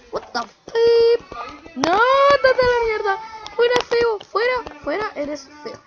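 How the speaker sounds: high, loud disgusted shouting; panicked yelling. Real, not acted. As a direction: yell at the ugly monster. Monster attacks player.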